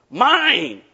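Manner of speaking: put-on high, loud childish cry of mine; possessive child yell. Boy shouting at kids on his swing set, possessive yell. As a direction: claim it like a jealous kid.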